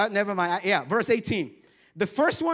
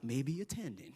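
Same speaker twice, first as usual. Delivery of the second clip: "Maybe attending" dropped much softer and lower; sheepish mumble; 9 semitones lower, 12 dB quieter than usual. Shown as a demonstration. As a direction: mutter "Maybe attending" noncommittally. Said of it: "so you're like".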